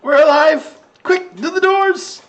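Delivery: very high, excited squeaky character voice; revived little creature. Acted. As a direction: come to amazed, then rally everyone. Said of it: "he looks around and goes".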